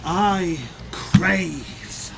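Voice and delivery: sly villain voice. Acted. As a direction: breathe the word excitement, hushed. GM voices Strife bartering.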